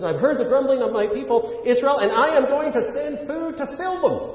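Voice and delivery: high-pitched, full-voiced divine promise; emphatic proclamation. Gives the Bible's real answer.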